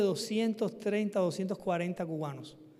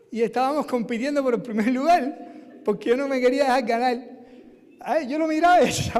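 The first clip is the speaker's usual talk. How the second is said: laughing; 4 semitones higher, 8 dB louder than usual. Real, not acted. Laughing at near-death rivalry.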